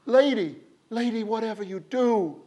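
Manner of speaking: high, loud frantic crowd cry; people crying out. A narrator's reading. Villagers warn Silver Hands of the wind, loud frantic cry. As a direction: cry out in desperate warning. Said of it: "the people cried in one voice".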